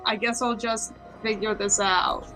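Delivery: ordinary talk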